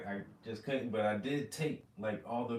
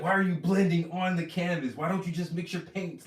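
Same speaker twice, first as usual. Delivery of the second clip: mentor's exasperation; 7 semitones higher, 8 dB louder than usual. Retold or imitated. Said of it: "He's like".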